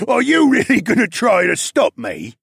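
laugh, then loud, high menacing taunt; dark laugh. A narrator's reading. Bear towering over the hero, laughing darkly. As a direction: laugh at a puny challenger. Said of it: "Nawa laughed darkly as he towered over me".

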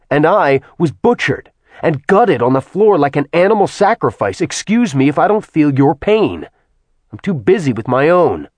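bury any hope of peace with rage; furious man accusing the goddess; cold fury. Acted. Acheron furious at Artemis.

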